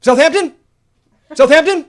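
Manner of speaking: high, very loud questioning shout, repeated; shrill-pitched mock yelling in separate bursts; imitated screaming. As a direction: mimic a frantic woman screaming a place name. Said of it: "just starts screaming at me".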